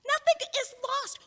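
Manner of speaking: an outburst that overrides him; sudden shout. Acted. Arguing with the heartbroken friend, she shouts him down. Heated argument over the breakup.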